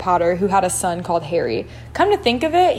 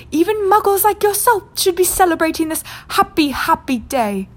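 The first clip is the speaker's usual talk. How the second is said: put-on squeaky old man; 7 semitones higher than usual. A narrator's reading. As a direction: squeak it out with joy. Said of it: "in a squeaky voice".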